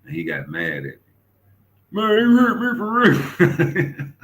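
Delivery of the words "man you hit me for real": high, loud, slow whine with a laugh; whining friend half laughing; high slow complaint with laughter; mock hurt whine.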